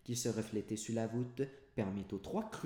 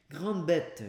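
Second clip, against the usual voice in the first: scolding master; 7 semitones higher, 7 dB louder than usual. A narrator's reading. Master scolds the clumsy servant.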